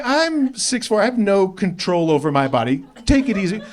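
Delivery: high, heated comeback to a meddling mother; worked-up, defensive son on the phone; indignant retort. Acted. Acted angry reply to his mom.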